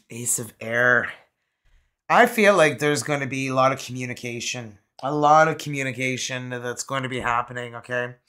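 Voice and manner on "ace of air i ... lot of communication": normal talking voice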